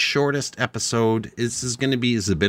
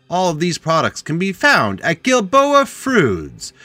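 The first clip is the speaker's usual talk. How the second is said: ad read; 7 semitones higher, 6 dB louder, slower than usual. Acted. Mock ad read continues.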